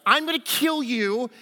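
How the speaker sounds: put-on boyish boast. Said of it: "He goes into detail".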